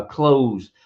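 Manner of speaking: normal talking voice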